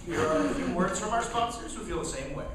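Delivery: ordinary delivery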